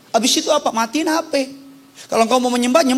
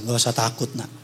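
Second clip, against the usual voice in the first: mother reassuring; 12 semitones lower, 4 dB quieter than usual. Retold or imitated. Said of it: "Terakhir dia bilang gini sama saya".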